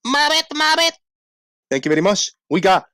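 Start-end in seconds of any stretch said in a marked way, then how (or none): 0.1-0.9 put-on high little baby frog mobbit. Streamer voicing the baby frog, high peep. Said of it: "Baby Frog goes".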